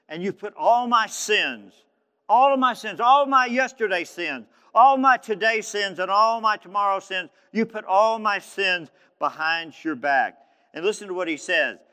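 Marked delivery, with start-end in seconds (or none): 0.1-10.4 high, loud, building list of sins; full-force preaching. Acted. Preacher declaring sins forgiven, high and fervent. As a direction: hammer each phrase from the pulpit.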